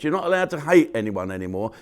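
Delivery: ordinary delivery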